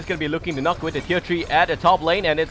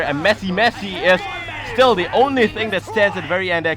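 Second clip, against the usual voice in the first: loud, high-pitched team fight call; live play-by-play; 7 semitones higher, 10 dB louder than usual. Real, not acted. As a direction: shout the action in an unbroken excited stream.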